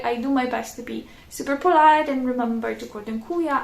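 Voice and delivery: ordinary talk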